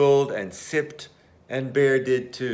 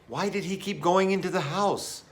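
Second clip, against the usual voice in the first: ordinary delivery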